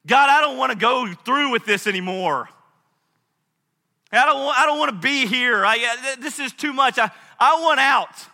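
loud, high, impassioned plea; desperate protest to God. Acted.

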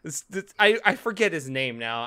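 ordinary delivery

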